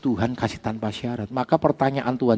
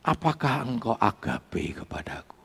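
soft, half-whispered question; hushed retold question; 6 dB quieter than usual. Jesus asks Peter a second time, hushed questioning. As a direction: drop to a breath and ask gently.

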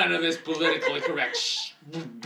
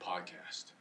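unvoiced, low whisper up close; leaning into the mic; 12 semitones lower, 15 dB quieter than usual. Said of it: "You don't have to lean into the mic".

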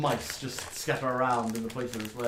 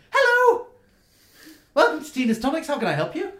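much higher, louder, bright and eager; bubbly gnome shopkeeper; 10 dB louder than usual. Acted. Gnome greeting the party at her shop counter, very high and chirpy. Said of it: "She goes".

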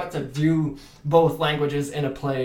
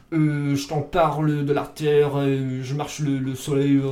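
put-on France French accent. Actor demoing France French to the host, Parisian accent. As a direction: muse lazily like a Frenchman from France. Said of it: "they'll say something like".

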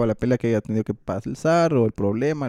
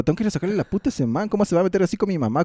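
fast, higher mock fury; hotheaded threat; 7 semitones higher, much faster than usual. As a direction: rattle it off like a hothead ready to fight. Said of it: "O tipo".

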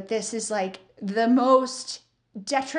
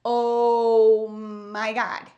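stunned slow oh my God; 3 dB louder, much slower than usual. Retold or imitated. Retold shock at hair complaint.

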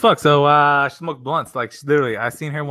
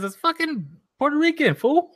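mock outrage; 13 semitones higher than usual. Real, not acted. Correcting chat, mock outraged.

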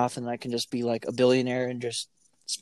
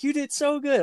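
high, singsong praise; praising a toddler; 13 semitones higher than usual. Retold or imitated. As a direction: gush over the kid. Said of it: "I'll be like".

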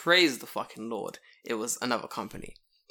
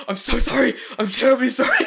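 imitating him, higher and louder through a laugh; super apologetic; 11 semitones higher, 11 dB louder than usual. Delivery man apologising to the foreigner, laughing mock-apology. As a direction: gush apologies while cracking up. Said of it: "he was, like, super apologetic".

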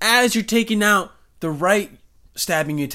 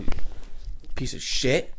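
muttered insult; 4 semitones lower, 4 dB quieter, much slower than usual. Real, not acted. Insulting his loud cat.

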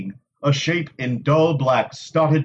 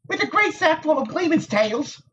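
retort; 13 semitones higher than usual. A narrator's reading. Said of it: "Nynaeve retorted".

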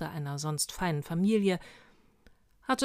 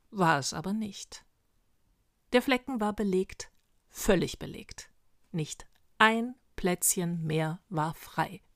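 ordinary delivery, nothing marked

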